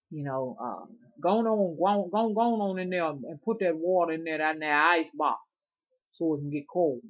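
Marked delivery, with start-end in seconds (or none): 1.2-7.0 louder country daddy voice giving orders; imitating daddy. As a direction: boss them like old daddy. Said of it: "He would be like this right here".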